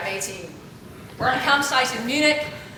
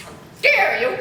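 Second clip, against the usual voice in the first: mock nun scolding; 6 semitones higher, 6 dB louder than usual. Nuns scolding a lying pupil.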